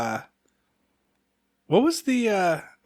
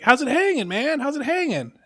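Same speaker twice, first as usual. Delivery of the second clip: high, insistent mock greeting; playful retold taunt, pitched way up; teasing kid friend; 11 semitones higher than usual. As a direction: imitate a kid needling his pal. Friend repeats the teasing question.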